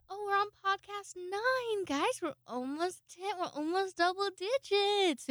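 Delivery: gushing to listeners about episode nine; excited. Real, not acted. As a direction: sell the milestone like a big win. Celebrating podcast milestone.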